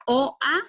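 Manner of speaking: O A said as two distinct vowels, rising on A; separated vowels. Shown as a demonstration. Teacher pointing out the hiatus to students, separated vowels. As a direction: split O and A apart. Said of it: "sí se separa".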